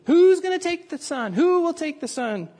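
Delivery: insistent auctioneer's plea; persistent auctioneer. Retold or imitated. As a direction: press the room to bid. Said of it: "But the auctioneer persisted".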